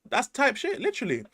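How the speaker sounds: high, excited outburst. Real, not acted. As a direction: jump up in pitch, thrilled. Excited agreement, pitch jumps.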